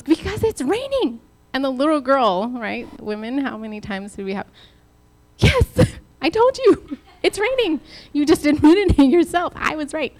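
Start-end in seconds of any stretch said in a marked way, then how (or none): 0.0-1.2 switching insistence. Retold or imitated. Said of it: "there's that one part where he switches when he goes". 5.4-10.1 higher and louder gloating, laughing at the start; crowing I-told-you-so between laughs; triumphant little girl. Retold or imitated. Retelling the girl's gloat to the congregation, laughing and triumphant.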